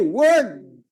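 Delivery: low shouted cue; called response. Shown as a demonstration. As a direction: call Word down to the crowd. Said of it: "Say it with me".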